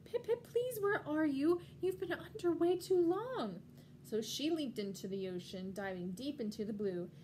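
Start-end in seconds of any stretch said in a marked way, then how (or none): 0.1-3.6 high, loud, worried mother calling; alarmed cry. Acted. Mommy penguin calls for her missing little one, alarmed. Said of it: "Then she cried out".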